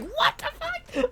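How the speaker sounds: very high, slow, laughing squeal; shrieking mock shock. Saika spitting out her drink, high laughing squeal. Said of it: "She's like".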